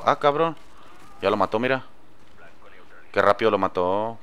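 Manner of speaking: ordinary talk, nothing marked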